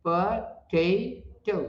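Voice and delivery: Potato very slowly in three separate parts, pa, tay, to; syllable by syllable. Shown as a demonstration. Teacher counts three syllables in potato, split apart slowly. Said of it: "तीन syllable है इसमें".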